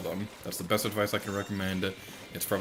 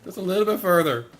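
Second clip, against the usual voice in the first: higher, louder urging; tense urging; 10 semitones higher, 8 dB louder than usual. Real, not acted. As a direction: push them on, rising. Urging teammates, building.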